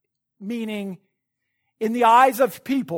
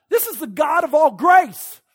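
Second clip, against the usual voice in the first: preaching shout; 6 semitones higher, 8 dB louder than usual. Real, not acted. Preacher stresses God's grace.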